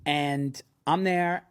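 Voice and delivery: ordinary talk, nothing marked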